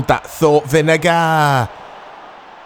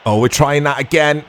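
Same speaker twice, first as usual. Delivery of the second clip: normal talking voice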